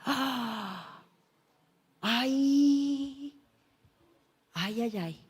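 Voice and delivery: soft, hammed-up lament sobs; a betrayed son's mock wail, trailing off. Esau wailing over lost blessing.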